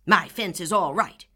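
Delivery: loud angry snap; snapped. Acted. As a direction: snap back at her. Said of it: "snapped Mister Harrison".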